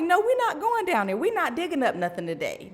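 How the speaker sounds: high-pitched emphatic refusal; retold firm refusal. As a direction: act out shutting the conversation down. Acting her refusal to daughter.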